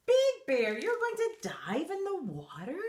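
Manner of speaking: high, amazed question; astonished. Acted. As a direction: gasp at the idea. Narrator amazed.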